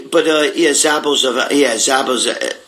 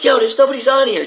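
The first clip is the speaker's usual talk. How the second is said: much higher, puzzled "Yo, there's nobody's on here"; retold complaint into phone; 11 semitones higher than usual. Retelling a call on his girlfriend's phone, higher puzzled voice. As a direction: act out talking into a muted phone. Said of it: "I'm like".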